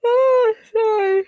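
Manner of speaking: high yawning squeak. Real, not acted. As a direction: let the yawn break through. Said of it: "Sorry for yawning".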